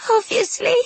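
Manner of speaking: very high, strained agreement; brave through tears. A narrator's reading. Ghost girl agreeing with friend, putting on bravery. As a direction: force out a brave little reply, pitched right up. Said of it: "forced a brave smile".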